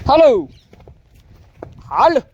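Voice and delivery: loud, high herding call. Real, not acted. Farmer to his animals, calling out loudly. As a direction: call out sharply to livestock.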